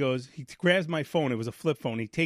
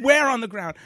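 higher, louder demanding question; impatient shout; 7 semitones higher, 7 dB louder than usual. Retold or imitated. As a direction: push back hard like a pushy dispatcher.

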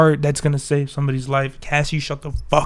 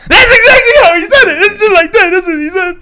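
very high, loud squealing laugh-talk; laughing shout; 10 dB louder than usual. Real, not acted. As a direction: shriek it through laughter. Cracking up at the imitation.

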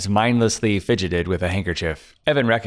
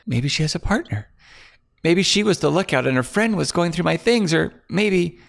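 higher, anxious woman blaming; fearful accusation; 9 semitones higher than usual. Acted. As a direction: point the finger in a scared voice. Claire voiced, anxious speculating.

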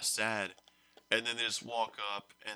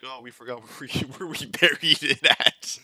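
sheepish students, laughing; 3 semitones higher, 13 dB louder than usual. Retold or imitated. Students admitting they forgot the spot, laughing. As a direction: admit it, cracking up. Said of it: "And they're like".

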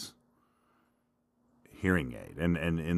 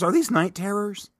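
squeaky question; 6 dB louder than usual. Acted. Self-questioning squeaky aside.

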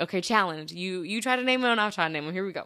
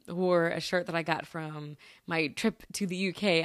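ordinary talk, nothing marked

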